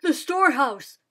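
father crying out at the fire; loud cry. Acted. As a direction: cry out in dismay. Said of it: "Father cried".

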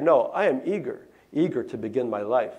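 ordinary delivery, nothing marked

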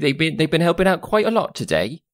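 high-rising scolding servant woman; Jazana, scolding. Acted. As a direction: pull the boy up sharply, mock-stern. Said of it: "And Jazana's like".

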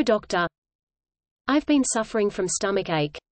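usual speaking voice

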